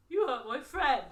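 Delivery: a lightly voiced hurt bear. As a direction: speak it as a wounded bear friend. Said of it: "In a bear voice".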